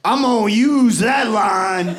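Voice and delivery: slow, loud, pleased audience guy; mock heckler. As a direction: announce it proudly from the front row. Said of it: "this guy in the front row goes".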